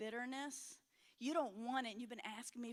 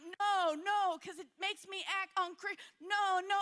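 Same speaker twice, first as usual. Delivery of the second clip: panicked refusal; 8 semitones higher, 11 dB louder than usual. Retold or imitated. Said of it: "you're like".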